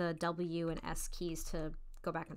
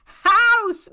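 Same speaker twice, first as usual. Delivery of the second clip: loud excited shouting, high; Mayor Tinsel shouting; 10 semitones higher, 16 dB louder than usual. Acted. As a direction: yell it out like the mayor. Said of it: "Mayor Tinsel says".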